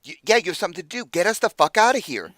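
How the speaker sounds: loud, high, fast indignant demand; angry outburst. Acted. Freed captive demands that rescuers get them out now, angry outburst.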